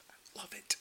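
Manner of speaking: delighted whisper; whispered aside. Real, not acted. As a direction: whisper it like a guilty pleasure. Gleeful aside after gory scene.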